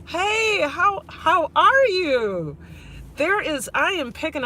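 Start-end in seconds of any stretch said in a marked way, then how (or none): 0.1-2.6 high, slow, sing-song hello; livestream greeting cadence. Shown as a demonstration. Showing the greeting cadence livestreamers all share. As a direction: swoop up on hey and stretch the how are you. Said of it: "we all are starting to get the same cadence".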